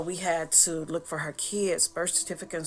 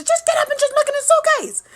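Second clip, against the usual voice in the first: very high, loud bossy yell; sister hollering; 12 dB louder than usual. Retold or imitated. Dead sister ordering her up, yelling. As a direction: order her up at full volume. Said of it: "She started hollering at me".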